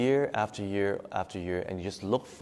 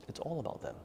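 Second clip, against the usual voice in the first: "It's all about them" repeated much softer and higher; softer echo; 5 semitones higher, 11 dB quieter, faster than usual. Shown as a demonstration. Teacher echoing to her meditation class, much softer and higher. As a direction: let "It's all about them" fall away to a near hush. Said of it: "repeated more softly".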